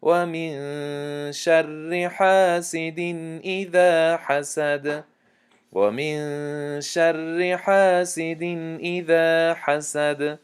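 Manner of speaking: slow melodic tajweed chant, drawn out; Quran recitation. Shown as a demonstration. Teacher recites al-Falaq to students in slow tajweed chant. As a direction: recite each verse slowly, measured and melodic.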